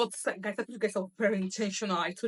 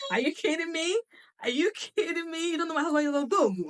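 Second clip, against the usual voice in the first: laughing mock outrage; 9 semitones higher, 5 dB louder than usual.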